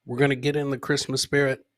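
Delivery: ordinary talk, nothing marked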